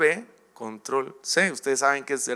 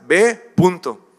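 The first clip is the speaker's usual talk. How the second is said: louder, slow, stern order; firm command from God; 3 semitones higher, 7 dB louder, slower than usual. Acted. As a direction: lay down the order with no room to argue. God answers excuses firmly.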